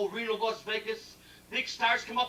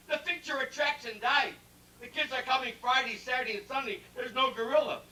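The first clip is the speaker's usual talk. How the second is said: usual speaking voice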